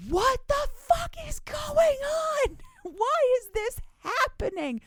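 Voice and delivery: high, loud panicked yell of disbelief; panicked shout. Retold or imitated. Heroine shrieking at the transforming monster in panic. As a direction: shriek it like the ground just vanished.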